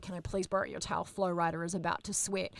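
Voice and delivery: quick, soft begging; hushed fast plea. Retold or imitated. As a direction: rush a quiet plea. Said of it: "I was like".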